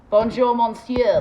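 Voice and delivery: "Bonjour, monsieur" belted out slowly and loud. Shown as a demonstration. Vlogger nailing her French greeting, loud and slow. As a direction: drag out "Bonjour, monsieur" like a proud tourist. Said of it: "There we go".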